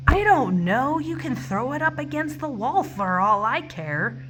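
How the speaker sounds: loud, high, fed-up snap at the caller; exasperated retort. Retold or imitated. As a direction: throw it back at him, exasperated and loud. Snapping back at caller.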